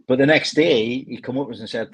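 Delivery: normal talking voice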